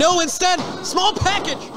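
high, quick excited call. Real, not acted. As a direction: catch the surprise pin. Surprise pin attempt.

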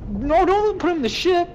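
high, loud yelp of panic; panicked shout. Real, not acted. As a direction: yell it out in alarm.

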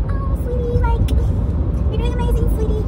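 high, sugary cheering voice; sweet encouragement. Retold or imitated. Therapist cooing encouragement to a child patient. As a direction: coo praise at a child patient. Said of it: "you also be like".

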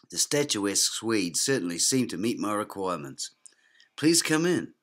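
ordinary delivery

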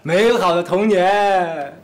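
very slow, drawn-out reminiscing; mock-nostalgic drawl. Friend recalling childhood mischief, mock-nostalgic drawl. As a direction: stretch every word like a fond sigh.

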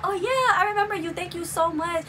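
high, gushing imitation of the star; Suki's thanks. Celebrity remembering the fan who reposts her, high gushing imitation. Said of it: "She was like".

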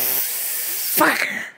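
slow, breathless whisper; whispered curse. Retold or imitated. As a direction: hiss it under your breath. Frustrated at missing the match.

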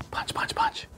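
rapid breathy whisper, mimicking strikes; whispered punches. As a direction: whisper each mock punch after a sharp exhale. Acting out karate moves along.

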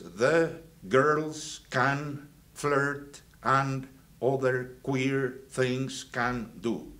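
very slow, word by word mnemonic; reciting the mnemonic. Retold or imitated. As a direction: spell out the memory sentence slowly for students. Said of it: "Remember this sentence".

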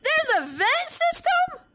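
high, amazed and delighted; excited. Real, not acted. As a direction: squeal it out in wonder. Discovering vent system, excited.

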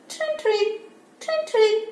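high squeaky rabbit noise; imitated rabbit squeak in a high voice; rabbit sound. Shown as a demonstration.

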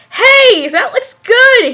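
high, slow cheery exclamation; mock-gleeful discovery; delighted. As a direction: lift it high with dopey delight. Snape suddenly delighted by custard.

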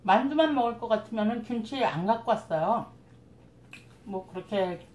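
speaking with food in mouth; words between bites; talking while chewing. Real, not acted. Seen 0: Food goes in at -4; cheeks full, lips working while speaking.

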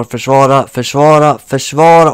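building from firm to loud, yelling; urgent yelling. Real, not acted. Urging defence during a match.

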